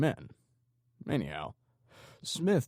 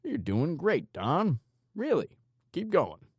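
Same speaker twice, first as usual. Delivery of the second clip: much higher, lilting praise; mock encouragement; 10 semitones higher, 4 dB louder than usual. A narrator's reading. Torturer praising his screaming victim, mock encouragement. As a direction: cheer him on, falsely sweet.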